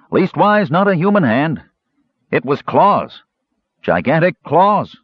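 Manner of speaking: high, emphatic guard's character voice. A narrator's reading. Guard correcting his fellow about gigantic claws, high-voiced. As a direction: pitch the guard well up, pressing each point. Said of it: "said another guard".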